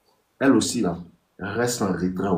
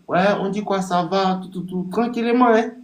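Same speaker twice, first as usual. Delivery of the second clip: mock casual reply; 10 semitones higher than usual. Coaching a friend, he acts the guy's casual reply up high. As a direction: toss it off like a cool young guy answering.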